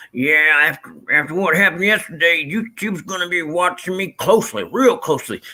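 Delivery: loosely mimicking a southern streamer; southern accent. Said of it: "you know, that, that southern accent".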